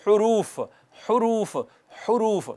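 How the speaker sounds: हुरूफ said softly with rounded lips. Shown as a demonstration. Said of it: "आप कहेंगे".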